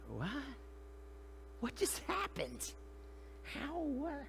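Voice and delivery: high, soft, slow bewilderment; hushed, high-pitched astonishment; stunned disbelief. Acted. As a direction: act dumbstruck, barely getting the words out. Stunned witness of the sudden calm.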